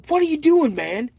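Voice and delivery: retold alarmed cry, pitched high; startled yell. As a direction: jump up and yell it. Startled, confronting brother.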